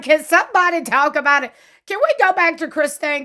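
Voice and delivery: practically pleading for the gossip; excited plea. Real, not acted. Host begging someone to spill.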